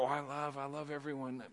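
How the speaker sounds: soft, self-pleased "I love everyone"; mock sanctimonious. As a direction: mimic the smug, fake-loving type. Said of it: "people sit there and go".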